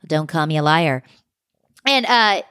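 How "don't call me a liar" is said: lower, curt voice for a rival; dismissive castmate. Retold or imitated. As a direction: quote her dismissively, pitched down. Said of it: "And she's like".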